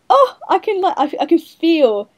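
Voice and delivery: high, emphatic burst easing slightly; retold gushing reaction. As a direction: act out being struck while reading. Retells own moved reaction to reading.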